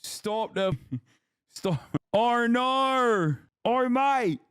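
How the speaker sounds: high, drawn-out British-or-Australian caricature, breaking into giggles; exaggerated mock British wail with laughs; mock British accent. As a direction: ham up a squeaky Brit accent while amused. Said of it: "if this is a British chatter or an Australian".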